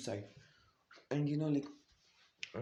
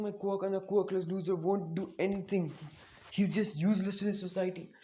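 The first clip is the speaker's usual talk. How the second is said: high-pitched, distressed confession; imitated crying, pitched up throughout; acted tearful outburst; 5 semitones higher than usual. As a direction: voice it as a daughter upset with guilt, no real sobs. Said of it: "start crying".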